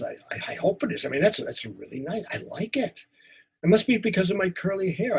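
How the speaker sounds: acted first-person story of a shy boy; a teacher acting out a memory story; story performance. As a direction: tell it as the scared twelve-year-old. Performs a story monologue for the class.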